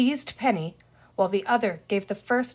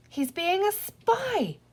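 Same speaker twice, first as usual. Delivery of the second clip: much higher, slow delighted whisper-thought; 10 semitones higher, slower than usual. Acted. Penny delighted, watching her dog spy, higher. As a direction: marvel gleefully. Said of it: "thought Penny with delight".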